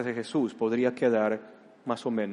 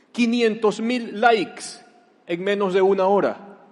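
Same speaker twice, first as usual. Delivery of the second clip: excited, higher hype; 7 semitones higher, 6 dB louder than usual. Acted. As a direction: announce it like a viral sensation. Exalta a la multitud. (entusiasmo fuerte y agudo, lento al inicio)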